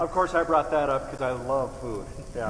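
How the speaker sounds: muffled, as if mic covered by sweatshirt. Real, not acted. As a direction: talk through muffled fabric. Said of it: "it sounds like you're a little muffled".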